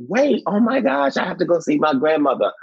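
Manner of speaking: sudden high "wait, oh my gosh"; excited realization. Retold or imitated. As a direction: jump up in pitch with sudden excitement. Excited realization in retold story.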